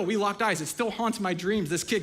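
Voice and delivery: ordinary talk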